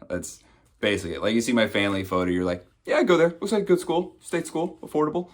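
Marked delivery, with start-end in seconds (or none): 2.9-5.3 high-pitched put-on approval; high comic imitation of a college shopper; mock prospective student. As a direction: voice an approving brochure reader, high and light. Voices viewer of brochure-like photo.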